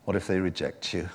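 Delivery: low, soft inner voice of doubt; quiet, low voice of mild fear; little fearful voice. Retold or imitated. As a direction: murmur it as a nagging inner warning. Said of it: "that little voice which is rooted in fear".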